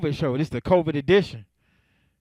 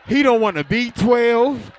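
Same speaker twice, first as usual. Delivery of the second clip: laughing; 6 semitones higher, 6 dB louder, slower than usual. Acted. Mocking the friend's words, laughing.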